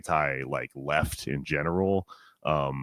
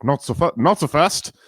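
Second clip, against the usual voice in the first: higher, louder mock Canadian shout with short vowels; mock Canadian yell; 9 semitones higher, 9 dB louder than usual. Imitating Canadians on TV, a loud mock Canadian yell. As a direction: bark it like an angry rural Canadian. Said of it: "yelling at you and they're angry".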